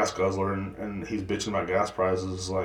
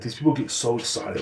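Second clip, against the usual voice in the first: ordinary talk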